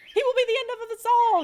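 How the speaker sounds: very high, loud, fast shout; frantic father mouse shouting at wife. Acted. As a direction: rage at the heavens. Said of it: "he shouted".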